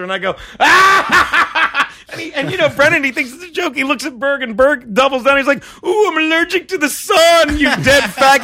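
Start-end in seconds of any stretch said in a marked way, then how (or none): 0.6-1.9 high, loud mock "ah!"; retold loud outburst. Comic reacting to a colleague's cancer story, loud outburst. Retells bursting out at the joke. 5.8-8.4 high, drawn-out mock whine turning loud; mocking taunt. Comic doubling down on the joke, loud high mockery.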